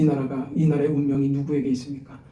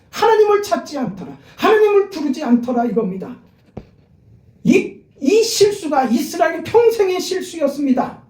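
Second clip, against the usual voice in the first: much higher and louder, pleading with the congregation; impassioned pulpit rebuke; fiery preaching; 10 semitones higher, 5 dB louder than usual. Real, not acted. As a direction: preach it at full force, voice ringing. Preaching at full force.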